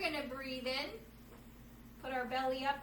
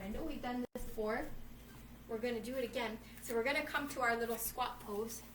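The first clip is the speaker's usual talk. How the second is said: ordinary talk, nothing marked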